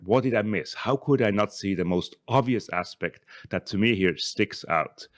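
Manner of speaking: usual speaking voice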